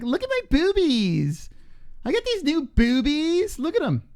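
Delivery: high, drawn-out mocking impression of her; mock showing off. Host imitating a woman flaunting her photos, mock showing off. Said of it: "She's like".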